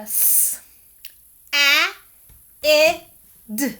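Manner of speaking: each letter sound of "s, a, I, d" on its own. Shown as a demonstration. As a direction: sound out "s, a, I, d" with a gap after each, loud short vowels. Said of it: "you would have it sounded out".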